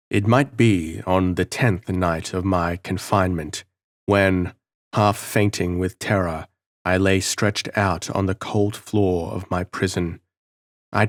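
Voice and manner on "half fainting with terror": ordinary delivery, nothing marked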